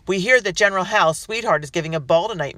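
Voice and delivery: loud, jeering shout; mocking shout. A narrator's reading. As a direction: taunt the house from outside. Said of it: "a man shouted".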